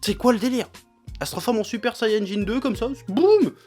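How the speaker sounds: usual speaking voice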